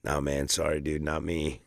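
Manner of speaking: low, quiet, offhand denial; playing coy. Denying he's [name] to a fan, playing it low and coy. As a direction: brush him off, playing dumb. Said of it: "I totally played the coy".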